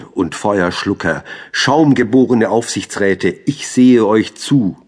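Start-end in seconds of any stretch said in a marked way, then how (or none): 1.5-4.8 raised, loud poetic address; accusing declamation. Acted. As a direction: call them out loudly, drawing out the end. Poem's accusing address to the brothers. (laut anklagend deklamiert)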